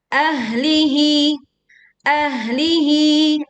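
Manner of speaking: "ahlihi" with no bounce after h; flowing h. Shown as a demonstration. Teacher modelling ha sukun, flowing recitation. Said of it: "Alirkan suara".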